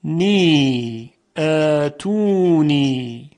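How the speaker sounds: syllable by syllable, each vowel drawn out; elongated syllables. Shown as a demonstration. Teacher demonstrating a word's long vowels, stretched. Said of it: "به این کلمه دقت کنید".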